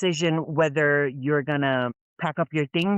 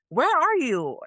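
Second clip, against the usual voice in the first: high, louder mock demanding mom; 11 semitones higher, 3 dB louder than usual. Mom demanding her daughter's whereabouts, high and mocking. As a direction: snap it high like a worried mother. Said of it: "She's like".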